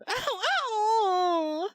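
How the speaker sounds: high yelping "ow, ow"; sharp cry of pain. Real, not acted. Streamer suddenly hurt mid-sentence, high yelp.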